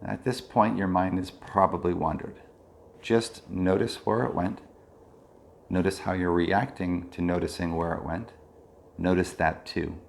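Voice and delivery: unhurried meditation leader, easing down at phrase ends; guided meditation. Real, not acted. Leads a body scan meditation.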